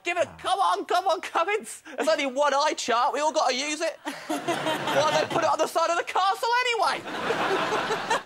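high, laughing mock complaint at Cummings; hammed-up heckle from the queue; mock impatient queue shout. Retelling the eye test queue, mock heckling Cummings. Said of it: "We were like".

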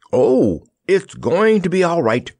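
high-pitched happy exclamation; elated, projected cry; joyful shout. A narrator's reading. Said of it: "he shouted joyfully".

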